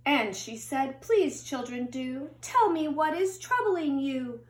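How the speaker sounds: storybook read-aloud, gentle and lilting; children's picture book narration. A narrator's reading. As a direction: read it like bedtime story time.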